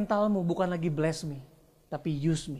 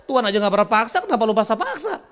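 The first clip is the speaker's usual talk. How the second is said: ordinary talk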